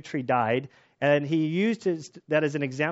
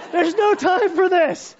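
high, loud, drawn-out scolding; mock exasperated yell; 8 dB louder, slower than usual. Wife in labor to weeping husband, mock yell. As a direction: imitate a wife yelling in labor. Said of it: "And Anna's like".

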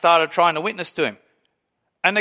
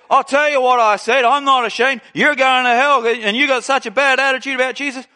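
raised, higher, unashamed street-preacher voice; defiant preaching; 5 semitones higher, 3 dB louder than usual. Retold or imitated. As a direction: preach it loudly at a man who's about to attack you. [name] preaching loudly.

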